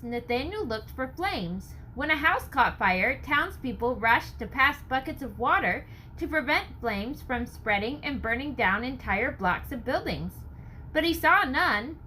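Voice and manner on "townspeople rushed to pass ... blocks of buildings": ordinary delivery, nothing marked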